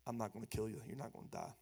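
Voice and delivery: whispered soft comfort; gentle whisper. Acted. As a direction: breathe it out to calm a frightened man. God comforting terrified Gideon.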